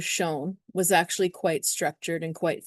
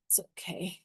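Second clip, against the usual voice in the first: breathy whisper, barely there; hushed whisper saying it's okay; 12 dB quieter than usual. Acted. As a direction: whisper it to yourself like a secret. Soft aside inside the acted Aries.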